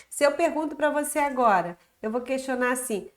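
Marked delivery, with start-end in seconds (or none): none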